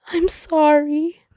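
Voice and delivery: high, slow, sobbing apology; a child-like sob of regret; tearful apology. Acted. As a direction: say sorry through tears. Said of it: "He sobbed softly".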